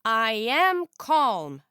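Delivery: a slow forced denial; snapping. A narrator's reading. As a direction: bite the words out. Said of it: "snapped Summer".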